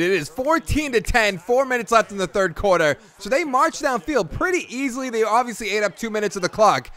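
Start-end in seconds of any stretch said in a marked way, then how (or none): none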